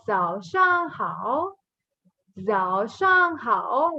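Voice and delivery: "zao shang hao" slow, with exaggerated tones; model pronunciation. Shown as a demonstration. Teacher models good morning, slow toned "zao shang hao". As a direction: dip and lift through "zao shang hao". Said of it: "So we go".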